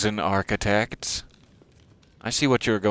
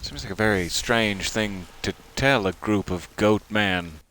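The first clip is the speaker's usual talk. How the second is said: ordinary delivery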